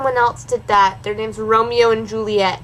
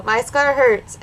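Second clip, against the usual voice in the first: ordinary delivery, nothing marked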